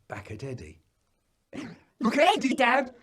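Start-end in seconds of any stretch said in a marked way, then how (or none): 2.0-3.0 put-on high, louder little boy telling tales; a child tugging at dad's sleeve; child's voice. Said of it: "says Joe".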